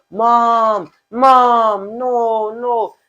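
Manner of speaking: high, crying child's plea, imitated. Child dragged from his mother, crying for her. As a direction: lightly act a small child crying for his mom. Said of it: "crying in high-pitched voices".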